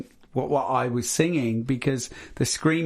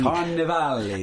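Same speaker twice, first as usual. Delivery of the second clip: put-on says his name with an Italian accent; 3 semitones higher than usual. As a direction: roll it out like an Italian. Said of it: "he's doing an Italian accent now".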